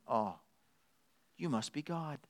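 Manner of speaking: very soft, humble dog's realization; lightly voiced dog, hushed in worship; soft-spoken adoring dog; awed dog. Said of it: "A dog says".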